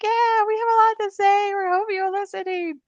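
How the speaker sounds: very high, squeaky put-on child voice; bunch of kids. Inner parts discovering a microphone, clamoring kid voices. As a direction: pipe up like excited kids grabbing the microphone. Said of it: "like a bunch of kids".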